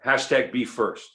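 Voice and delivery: usual speaking voice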